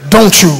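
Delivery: dontchu with the t and y run together; "Don't you" said as two syllables, dontchu. Shown as a demonstration. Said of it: "It's two syllables".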